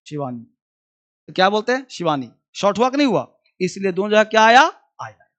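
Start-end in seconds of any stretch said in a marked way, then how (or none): none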